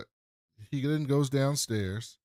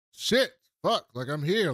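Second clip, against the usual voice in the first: mock loud arrival; 6 semitones higher, 5 dB louder, slower than usual. Drunk character arrives at dinner.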